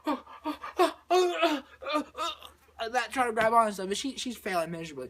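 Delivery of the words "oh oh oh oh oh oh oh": high, gasping cries; breathless yelps, scrabbling for a hold; panicked flailing. Retold or imitated. She's trying to grab onto stuff, panicked.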